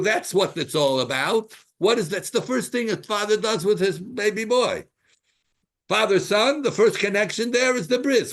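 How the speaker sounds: loud, high, insistent preaching; impassioned preaching. Real, not acted. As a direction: drive the teaching home with force.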